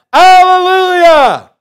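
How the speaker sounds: long, high, very loud shout; shouted praise. A narrator's reading. Preacher voicing heaven's people praising God, drawn-out shout. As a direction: belt it out, stretching the word right out. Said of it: "And again they said".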